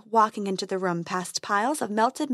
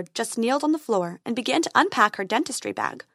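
ordinary delivery, nothing marked

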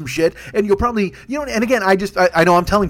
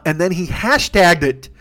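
normal talking voice

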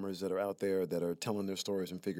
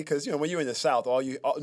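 ordinary talk

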